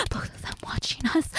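quick hushed whisper. Acted. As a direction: rush it out in a whisper. Watched, aroused roleplay.

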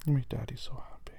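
soft loving whisper. Acted. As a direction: breathe it tenderly. Tender whisper to partner.

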